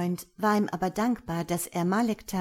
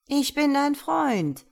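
high, slow, honeyed coaxing; sweet-talking; 8 semitones higher, 6 dB louder, slower than usual. A narrator's reading. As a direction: coax the snake sweetly, higher. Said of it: "säuselte er".

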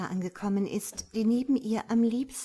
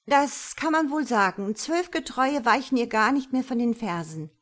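louder, higher, hurried reply; hasty answer; 4 semitones higher, 7 dB louder than usual. A narrator's reading. Michaela answers God under her stare, hastily. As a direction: answer in haste, voice raised. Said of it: "antwortet diese hastig".

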